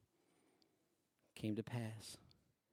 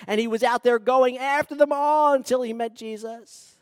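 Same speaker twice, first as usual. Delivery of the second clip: loud, higher, rising preacher's voice; preaching at full force; 11 semitones higher, 17 dB louder than usual. Acted. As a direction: build it up like a revival sermon. Preaching Paul's story.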